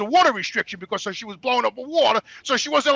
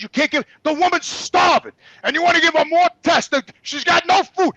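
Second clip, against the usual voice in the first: higher, heated indignation; angry protest; 6 semitones higher than usual. Retold or imitated. Son fighting the nurse for mother's food, angry. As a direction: argue outraged on someone's behalf.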